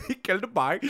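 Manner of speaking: hammed-up Scottish accent; mock Scottish accent. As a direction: laughingly echo it in Scottish. Repeating the Scottish bit.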